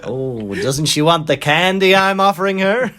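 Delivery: pushy hammed-up American accent. American pestering a family at dinner, loud American accent. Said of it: "like me being American".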